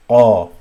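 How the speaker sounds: qa struck loud at the top of the throat; full qa. Shown as a demonstration. Teacher showing the same place, loud full throaty qa. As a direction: give qa a hard, full k far back. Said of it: "it's a full sound".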